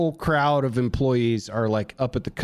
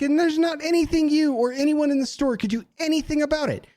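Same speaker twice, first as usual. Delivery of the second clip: high-pitched defiant rant; losing it; 14 semitones higher than usual. Retold or imitated. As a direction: challenge them angrily, pitch held high. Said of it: "I lost my mind".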